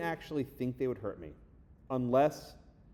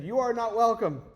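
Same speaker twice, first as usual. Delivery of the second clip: mock protester shout; 10 semitones higher, 11 dB louder, slower than usual. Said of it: "They said".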